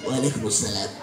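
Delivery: low soft grudging waalaikumsalam; mock low mumbled reply. Preacher mimics an interrupted worshipper answering, low and soft.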